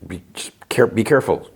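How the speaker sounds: imitating someone unsure what to say; hesitant. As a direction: falter and restart, as if searching for words. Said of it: "he's not sure what to say".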